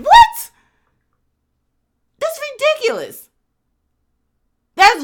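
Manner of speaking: high, loud shocked cry of what; incredulous shriek. Real, not acted. To her cohost about the daughter's grudge, shrieking in disbelief. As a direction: screech it in disbelief.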